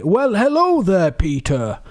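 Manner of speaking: a jolly Irish Santa, drawn out; Irish accent. Retold or imitated. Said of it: "Irish accent like that?".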